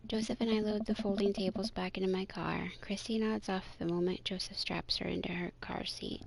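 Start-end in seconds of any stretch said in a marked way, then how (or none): 2.8-6.2 hushed narration; whispering. A narrator's reading. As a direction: whisper so the child stays asleep. Child asleep in car.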